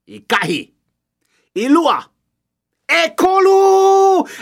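building count, ending in a long shout; race countdown. Acted. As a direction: call the start, stretch the last number. Referee counting to start race.

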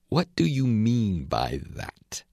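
slow, breathless whispered challenge; stern whispering caterpillar. A narrator's reading. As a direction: hiss it out slowly, demanding an answer. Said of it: "said the caterpillar sternly".

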